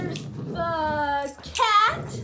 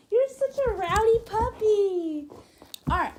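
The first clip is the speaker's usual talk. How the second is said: normal talking voice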